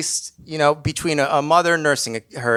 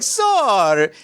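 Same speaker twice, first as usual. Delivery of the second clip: put-on higher, accented "Sir"; hint of a Slavic mentor; 5 semitones higher than usual. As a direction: summon the stranger's voice like a guru. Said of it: "this Slavic guy comes into my head saying".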